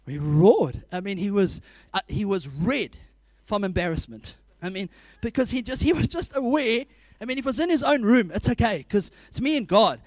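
ordinary talk

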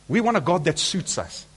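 ordinary talk

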